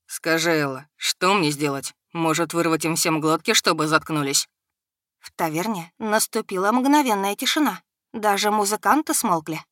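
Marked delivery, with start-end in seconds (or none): 0.1-4.5 low menacing dragon. A narrator's reading.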